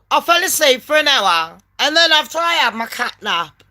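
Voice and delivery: ordinary talk, nothing marked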